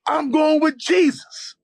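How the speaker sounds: very high, slow proclamation; retold fervent shout. Answering the friend in the street, retold fervent shout. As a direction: call it out high and drawn out.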